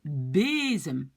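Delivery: bezem with a long, stressed first E; stress on first syllable. Shown as a demonstration. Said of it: "l'accent est ici". (bezem, accent appuyé sur la première syllabe)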